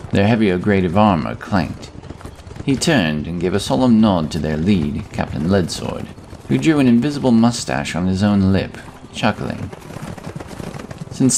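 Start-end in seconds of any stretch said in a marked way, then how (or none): none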